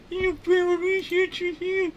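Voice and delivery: crazy scientist, extremely high and loud; very high, forceful character voice; Larry. Acted. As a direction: squeak it out as a crazy cartoon researcher. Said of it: "I did a crazy voice for that guy".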